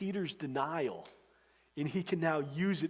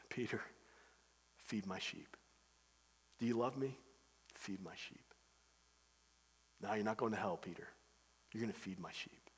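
gentle Jesus; 7 semitones lower, 11 dB quieter than usual. Retold or imitated. Jesus gently restoring Peter.

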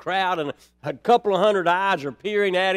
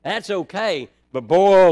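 ordinary talk, nothing marked

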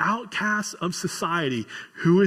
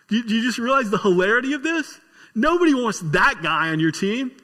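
higher, louder, amazed preaching; amused disbelief; 7 semitones higher, 3 dB louder than usual. Real, not acted. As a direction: marvel at how absurd it is. Preacher marvels at the hilarity.

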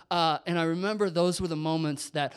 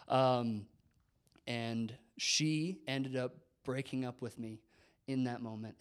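ordinary talk, nothing marked

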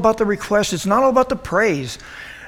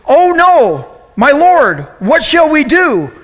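higher, louder, frantic cry of alarm; panicked servant; 6 semitones higher, 4 dB louder, slower than usual. Acted. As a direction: play the terrified servant begging his master. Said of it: "he goes".

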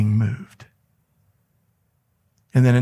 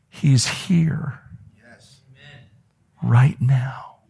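whispered, reverent and intimate; voiceless church whisper; hushed whisper; much slower than usual. Real, not acted. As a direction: lean in and breathe it to the room. Pastor whispers God's presence.

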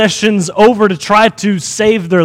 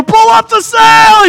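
high, drawn-out shout across the deck; sailor's scream; 13 semitones higher, 3 dB louder, slower than usual. Retold or imitated. Sailors screaming to each other in the storm, shouted. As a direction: holler it long and high, imitating a sailor. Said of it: "everybody's screaming back and forth".

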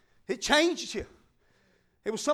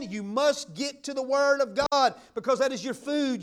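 ordinary delivery

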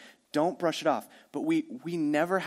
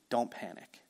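gentle, softer don't panic; soft calm reassurance; 4 semitones lower, 8 dB quieter than usual. Acted. Preacher voicing Jesus, gently soothing the worried disciples. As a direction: say it softly to calm a scared friend.

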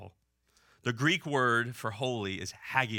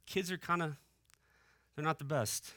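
hesitant, higher and softer; reluctant admission; 5 semitones higher, 5 dB quieter than usual. Acted. Couple privately weighing up kids, reluctant admission. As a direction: ease it out quietly, in halting pieces.

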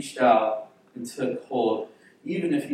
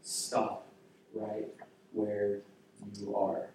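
quiet command; 2 semitones lower, 8 dB quieter, slower than usual. Retold or imitated. Jesus halting the stretcher of death, quiet command. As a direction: halt them quietly, pausing between words. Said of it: "and says".

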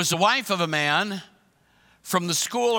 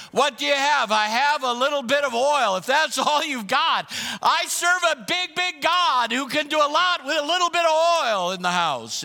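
preaching climax; 6 semitones higher than usual. Real, not acted. Preacher proclaiming a big God to the congregation. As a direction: build it to a triumphant peak.

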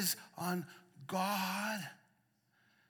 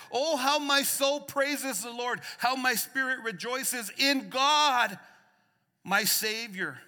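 reading Mary's praise; 8 semitones higher, 10 dB louder than usual. Real, not acted. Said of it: "Mary responded".

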